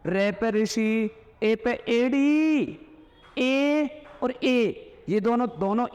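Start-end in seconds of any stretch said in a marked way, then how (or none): 0.0-2.8 रे पे ऋषि, ए पे एड़ी spelled out very slowly; slow mnemonic. Shown as a demonstration. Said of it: "teaching vowel mnemonic". 3.4-5.0 ए और ए stretched out; very slow dictation. Shown as a demonstration. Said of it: "dictating vowels slowly".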